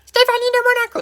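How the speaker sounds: very high, loud squeal of a dim contestant; clueless quiz contestant. Retold or imitated. Quoting Les Inconnus' quiz sketch, a very high loud blurt. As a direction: blurt the wrong answer in a shrill falsetto. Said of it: "c'est comme dans Les Inconnus".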